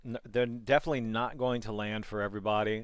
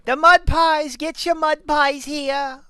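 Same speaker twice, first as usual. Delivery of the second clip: much higher, louder, slow hawker's call; high-pitched street vendor; 13 dB louder, slower than usual. Retold or imitated. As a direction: cry it out like a squeaky peddler.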